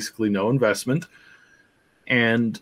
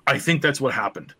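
ordinary talk, nothing marked

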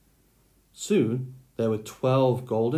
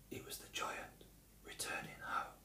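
whisper; 19 dB quieter than usual. A narrator's reading. Narrator hushes giant's arrival.

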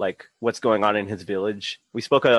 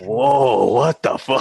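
mock shock; 3 semitones higher, 5 dB louder, much slower than usual. Mock shock retold to friends.

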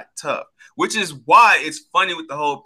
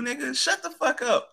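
pitched up, scoffing; high, scornful shut-up to a rival; high mock dismissal; 10 semitones higher, 5 dB quieter than usual. Real, not acted. Mocking rant, pitch up.

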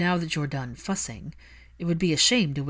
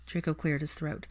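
usual speaking voice

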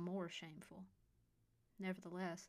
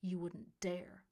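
countering; slower than usual. A narrator's reading. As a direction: dare him, slowly. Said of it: "Bryce countered".

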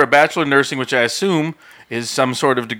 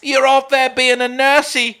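normal talking voice

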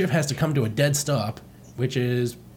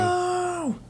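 high yell; 3 dB quieter than usual. Acted. Voicing Fox yelling.

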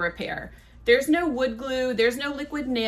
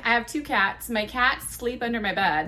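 ordinary delivery

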